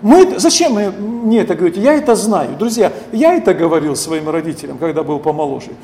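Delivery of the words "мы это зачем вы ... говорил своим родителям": ordinary talk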